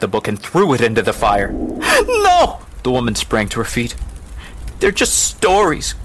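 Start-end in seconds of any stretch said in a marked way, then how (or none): 1.5-2.6 very high cry of protest; horrified cry. Acted. Book is thrown into fire. 4.8-5.9 put-on high, slow protesting child; girl's cry. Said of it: "cried the girl".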